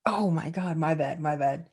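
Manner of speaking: normal talking voice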